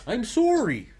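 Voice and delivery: high swooping Canadian apology; mad Canadian. Pretend. Owning up to turning mad Canadian, exaggerated Canadian accent. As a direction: overdo the Canadian "sorry". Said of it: "Yeah, I turned mad Canadian".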